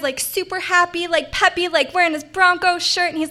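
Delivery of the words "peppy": usual speaking voice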